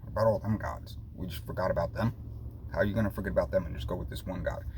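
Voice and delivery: ordinary delivery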